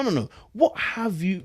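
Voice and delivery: usual speaking voice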